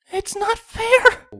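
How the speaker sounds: a timid pony squeaking out her distress. Acted. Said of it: "Fluttershy squeaked".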